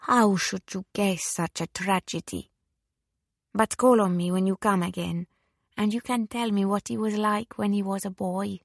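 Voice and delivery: put-on forgive and invite in an Italian accent; gentle Italian widow, lightly voiced; Italian widow, gracious. Italian widow invites visitor back.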